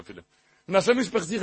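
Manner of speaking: normal talking voice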